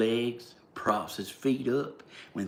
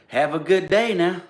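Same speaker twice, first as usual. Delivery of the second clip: mock friendly farewell; 8 semitones higher, 7 dB louder than usual. Mock-friendly goodbye to the president.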